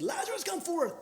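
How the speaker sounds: Jesus's call to Lazarus, lightly echoed high; mock call. Shown as a demonstration. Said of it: "like he did".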